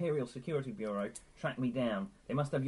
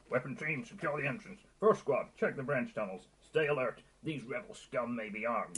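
stern stormtrooper. Acted. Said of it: "a stern, muffled voice bark".

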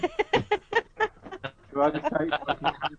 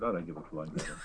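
mock disinterest; 10 semitones lower, 11 dB quieter than usual. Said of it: "I'll be really insulting and not interested".

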